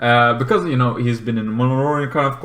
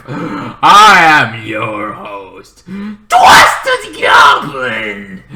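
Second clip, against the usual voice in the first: very slow, louder, grand host introduction; theatrical drawn-out host announcement; announcer self-intro; 7 dB louder, much slower than usual. Acted. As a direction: stretch out the name like a ring announcer.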